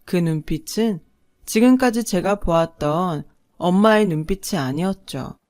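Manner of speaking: usual speaking voice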